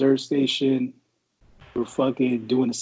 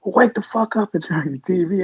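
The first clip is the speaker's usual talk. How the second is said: higher, louder order; barking sergeant; 6 semitones higher, 3 dB louder than usual. Retold or imitated. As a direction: bark it like a sergeant.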